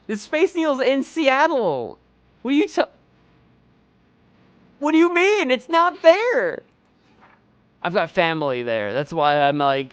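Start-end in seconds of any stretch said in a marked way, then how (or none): 0.1-6.6 high, loud incredulous outburst; loud, high-pitched disbelief, as if the landmark were stolen; shocked rant, much higher and louder. Real, not acted. Incredulous about the missing landmark.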